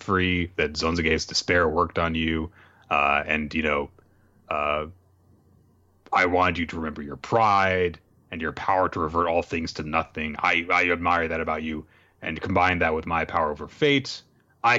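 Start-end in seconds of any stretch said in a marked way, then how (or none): none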